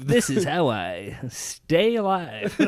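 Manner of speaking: high, loud, very slow announcer voice; radio DJ voice. Pretend. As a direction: stretch every word like a radio host. Mocking radio DJs.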